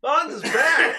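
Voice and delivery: loud, high announcement; big cheering call-out; excited shout. Real, not acted. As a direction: whoop that someone has returned. Announcing a viewer's return.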